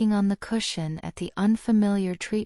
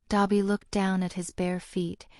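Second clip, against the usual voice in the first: ordinary delivery, nothing marked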